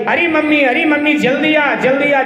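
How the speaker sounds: high, urgent calling for mummy; sudden cry. Acted. Bride urgently summons mother-in-law to the churn, crying out. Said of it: "एकदम से चिल्लाई".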